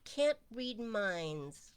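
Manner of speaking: drawn-out can't; slowing right down to stress; slow and emphatic. Real, not acted. Emphatic point.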